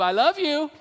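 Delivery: put-on dad laughing, teasing his kids. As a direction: laugh it out as a playful threat. Playful threat to call back.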